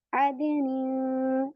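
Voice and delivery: अदनी very slow with a thin nasal hum; light ghunna. Shown as a demonstration. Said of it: "गुन्ना बारीक करेंगी आप". (बारीक गुन्ना के साथ अदनी)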